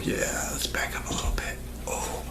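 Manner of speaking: faint breathy hush, a bit quick; whisper. Acted. Whispering to the viewer after getting too close. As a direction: drop to a whisper as you back away.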